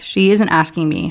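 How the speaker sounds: usual speaking voice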